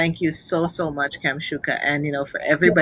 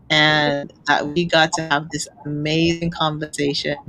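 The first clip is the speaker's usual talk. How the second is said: ordinary delivery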